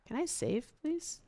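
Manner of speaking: ordinary talk, nothing marked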